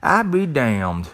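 slow, drawn-out country twang; playful demo of a country twang. Shown as a demonstration. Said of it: "Let me say that with a country twang".

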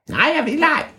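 very high squeaky creature voice; tiny high creature voice; ævle bævle voice. Acted. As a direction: squeak back like a cheeky hidden creature.

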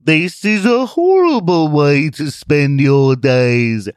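slow, high, loud scorn; sinister television. Acted. Television belittling Zealot, slow sinister voice. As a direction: sneer it like a scheming machine.

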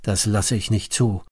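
usual speaking voice

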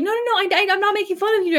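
high, fast, flustered denial; flustered high protest. Acted. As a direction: scramble to deny it, high and quick. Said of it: "Kyle's like".

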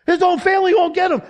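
high, loud pleading protest; alarmed objection. Acted. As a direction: play a worried objector trying to stop someone. Acted objection to Joseph.

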